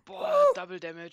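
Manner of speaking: high, loud amazed cry; excited shout. Real, not acted. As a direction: belt it out in amazement. Excited at power-up.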